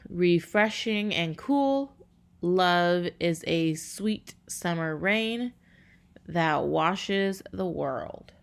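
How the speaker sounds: slow, measured haiku reading; reading a haiku slowly. Shown as a demonstration. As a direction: let each syllable of the poem land. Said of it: "they just broke it down here".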